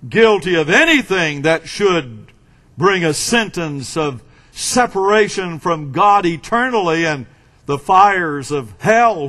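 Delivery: ordinary talk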